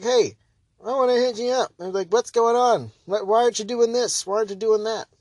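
high, chatty mock call to an official; imitating a caller. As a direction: lightly play an eager citizen phoning in. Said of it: "wants to be like".

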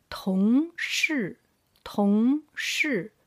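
同事 with 同 rising and 事 falling; second tone, fourth tone. Shown as a demonstration. Teacher showing the tone pair, 同 rising, 事 falling. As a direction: sweep 同 up, drop 事 down. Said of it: "second tone with the fourth tone".

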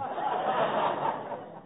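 unvoiced snickering laugh; breathy giggle. Real, not acted. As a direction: snicker at the joke. [name] laughs at own joke.

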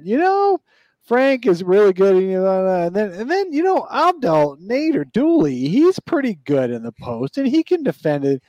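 high, hammed-up coach voice; mock the coach's press talk, up high; imitating Monty. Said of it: "He did a press conference where he said".

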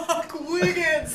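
laughing outburst. Real, not acted.

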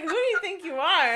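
laughing mock outrage. Mock indignant retort, laughing.

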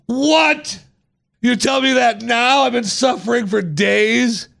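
high, incredulous outburst; outraged disbelief. Retold or imitated. Reacting to the owner's bad-heart news, high and outraged. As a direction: sound shocked and wronged.

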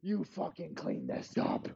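very soft menacing order; menacing quiet order. Acted. Elway quietly orders the ball boy to clean up.

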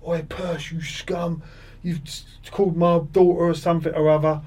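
high, mock heckling fan voice; hammed-up irate supporter shouting abuse; angry heckler. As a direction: jeer at him like a riled-up local fan from the touchline. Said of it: "He's like".

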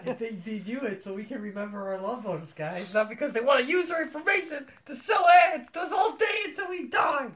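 mock defense building to a high shout; sarcastic reassurance erupting into a shrill scream; sarcastic rant into yelling.

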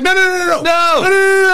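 high, frantic string of no's; panicked protest, high and frantic; mock panicked protest. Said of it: "I was like".

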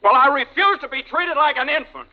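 indignant outburst. Acted. Quarrel with father.

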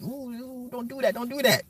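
high, soft, pained squeal; squeamish pleading. Retold or imitated. Streamer pleading with the movie screen, squeamish. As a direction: squeeze it out small and high.

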